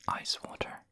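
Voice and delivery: ordinary talk, nothing marked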